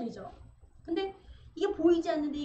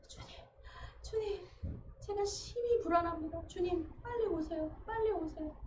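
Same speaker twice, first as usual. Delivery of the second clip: anxious quiet prayer; 5 semitones higher, 6 dB quieter than usual. Acted.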